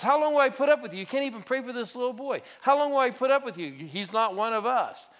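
loud, exasperated rebuke; exasperated Jesus. Acted. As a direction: scold the disciples with loud frustration. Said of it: "So Jesus says".

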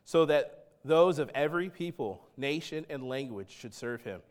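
measured, slowed prophetic reading; scripture reading. Acted. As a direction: read the vision with gravity. Reading Daniel seven aloud.

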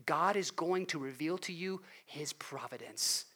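hushed promise; soft whispered assurance. Real, not acted. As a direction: whisper it close, like a secret. Intimate promise to congregation.

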